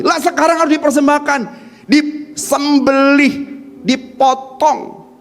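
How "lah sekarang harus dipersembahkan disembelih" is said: ordinary delivery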